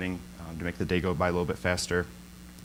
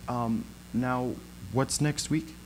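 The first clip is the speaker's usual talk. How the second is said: a timid, higher little question; shy question; 4 semitones higher, 4 dB quieter, much slower than usual. Retold or imitated. Coworker Katrina shyly asks the group, timid high question. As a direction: ask it shyly, as if you fear it's obvious. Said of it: "she shyly asked the group".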